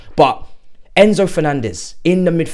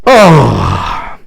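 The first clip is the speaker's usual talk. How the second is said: loud, drawn-out joyful "oh" shout; ecstatic yell; 4 semitones lower, 10 dB louder than usual. Real, not acted. Fan howling with delight after the signing. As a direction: let a wordless yell of joy burst out.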